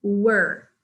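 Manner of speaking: "Were." said as wur, no diphthong; "Were." with an r-coloured vowel like fur; pronunciation of were. Shown as a demonstration. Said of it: "pronunciation of were".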